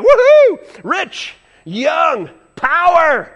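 high loud woohoo cheer; a sudden high cheer; excited whoop. Pretend. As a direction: whoop like a thrilled fan. Preacher mimics excited cheer.

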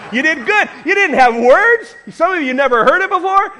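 high, loud encouragement; excited praise. Real, not acted. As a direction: cheer them on in a high voice. Hyping up the congregation.

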